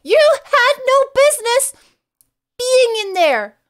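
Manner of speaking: high, loud indignant cry; hammed-up outrage, dragging out the last words; mock outraged scolding. Mock scolding Rachel in a roast.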